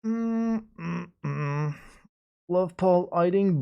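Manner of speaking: usual speaking voice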